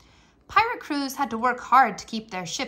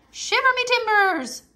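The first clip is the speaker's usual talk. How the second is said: excited pirate shout; 11 semitones higher, 5 dB louder than usual. Acted. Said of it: "Talk Like a Pirate".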